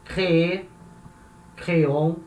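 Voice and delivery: "créer, créons" with both syllables sounded; strong last syllable. Shown as a demonstration. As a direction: voice the endings of "Crê, créon". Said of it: "pronuncio essa última sílaba".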